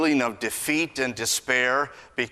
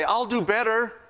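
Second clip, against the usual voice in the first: put-on pleading child; 11 semitones higher, 4 dB louder than usual. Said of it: "I don't have to say".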